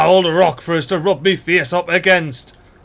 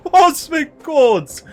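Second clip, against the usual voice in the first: laughing, slow, high delight; giggly mock-grand cosmic quartz; laughing exclaim; 11 semitones higher, 4 dB louder, much slower than usual. Real, not acted. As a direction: savour the words through a laugh. Delighted repeat of a phrase.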